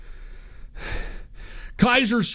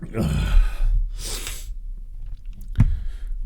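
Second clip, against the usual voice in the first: heavy sigh. Real, not acted. Long sigh after rant.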